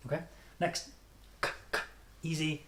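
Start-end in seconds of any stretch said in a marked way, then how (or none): 1.4-1.8 "K" as a bare release, no voice; isolated "k" bursts without a vowel; voiceless K sound. Shown as a demonstration. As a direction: pop the "k" from the back of the mouth. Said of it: "Same as Japanese".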